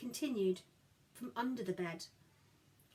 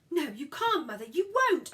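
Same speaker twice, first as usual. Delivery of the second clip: loud, high shout at mother; a girl shouts to stop her mother; 5 semitones higher, 7 dB louder than usual. A narrator's reading. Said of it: "I shouted".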